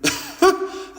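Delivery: brief high chuckle; short laugh. Real, not acted. Speaker jokes about being a veteran, short amused laugh. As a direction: laugh lightly at your own line.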